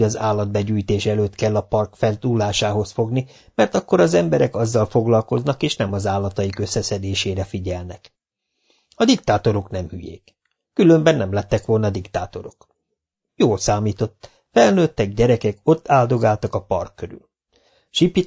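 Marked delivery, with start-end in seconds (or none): none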